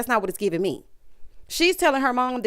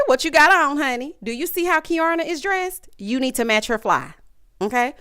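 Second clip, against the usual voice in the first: normal talking voice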